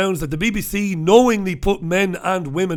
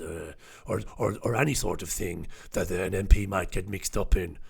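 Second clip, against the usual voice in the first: low, soft, halting mock confession full of uhs; old whip impression; 10 semitones lower, 9 dB quieter than usual. Retelling the former Chief Whip's BBC admission, low halting mock voice.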